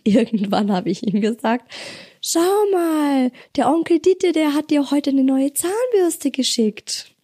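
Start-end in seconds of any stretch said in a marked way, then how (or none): 2.2-7.1 high, sweet coaxing; high coaxing mom voice. Acted. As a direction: sell the new toothbrush. Coaxing child with gift story.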